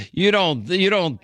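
high laugh running into the words; amused laughter. Real, not acted. Host laughing at caller's accent denial, amused. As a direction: burst out laughing, then start talking.

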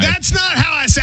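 indignant, pitched well up; high-pitched protest. Real, not acted. Host insisting the impression is wrong, high protest.

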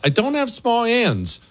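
high-pitched, hammed-up objection; mock indignant protest. Host answers listener's small gloves jab, mock indignant.

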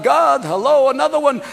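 high-pitched calling out; calling to God. Acted. Moses calls God for another miracle, high holler. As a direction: call upward for attention, pitched high. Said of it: "calls to God".

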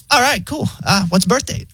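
high, casual AI voice; chill robot operator. Acted. Pharmacy AI asks for birth date, chill. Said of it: "like, so chill".

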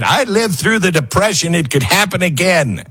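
lightly voiced grandma, matter-of-fact; grandmother voice. Quoting his grandmother, a hint of an old woman.

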